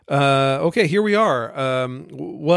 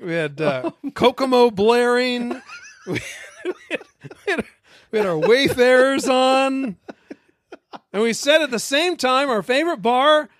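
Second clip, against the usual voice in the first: higher, laughing overblown podcast read; eager audition; 10 semitones higher than usual. Acted. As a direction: ham up a podcast intro. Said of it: "I'm auditioning for, for the role of podcaster".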